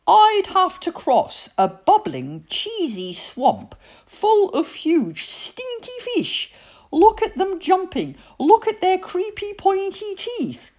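put-on very high, loud boyish alarm; high-pitched, dramatic dread; horrified boy. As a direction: squeal about the monsters in horror. Victor imagines a horrible swamp.

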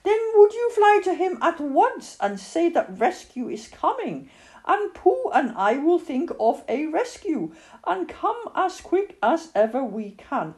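put-on high, urgent child character; Christopher Robin. A narrator's reading.

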